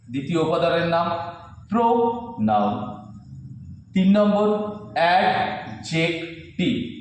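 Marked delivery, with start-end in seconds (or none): 1.7-3.0 pronoun slowly as pro-noun; syllable by syllable. Shown as a demonstration. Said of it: "syllable ভেঙে ভেঙে বলতে হবে". 4.9-6.9 adjective slowly, cut into অ্যাড-জেক-টিভ; a clear gap after each part of adjective; syllable by syllable. Shown as a demonstration. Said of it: "syllable ভেঙে ভেঙে বলতে হবে".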